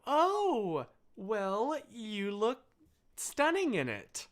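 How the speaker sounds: high, slow, admiring mom voice; doting mom. Retold or imitated. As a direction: gush lovingly like a proud mother. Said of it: "And she'd be like".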